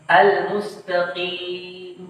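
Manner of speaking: slow "Al-mustaqim" trailing on a long ee; "Al-mustaqim" as "al-mustaqii", lips not closing; dropped final mim. Shown as a demonstration. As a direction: leave the m off "Al-mustaqim". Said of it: "tidak menampakkan huruf mim".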